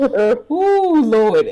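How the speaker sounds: high, loud laughing whoop; loud laughing cry. Real, not acted. Laughing at herself, to the audience: a loud high whoop.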